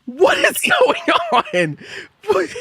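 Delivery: very high, drawn-out incredulous wail; helpless disbelief. Real, not acted. Streamer losing it at chat, helplessly incredulous. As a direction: stretch it out high, on the edge of laughter.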